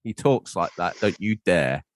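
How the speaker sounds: usual speaking voice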